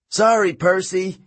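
high, restrained yell; high-pitched holler to someone at a distance; yelled apology. A narrator's reading. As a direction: call it across to a rival chariot. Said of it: "he yelled".

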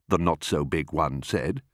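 plain reading voice

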